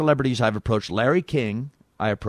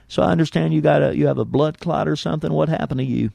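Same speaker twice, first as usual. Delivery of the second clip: presidential phone-call impression, pitched up; Bill Clinton; 5 semitones higher than usual. Prank caller asks Quayle about blood clot in Clinton impression. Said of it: "call the hospital as Bill Clinton".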